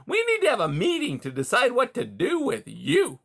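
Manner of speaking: high, loud chuckling voice; chortling beaver. A narrator's reading. Beaver gloating to caged Rye, high chortling. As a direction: chortle it smugly.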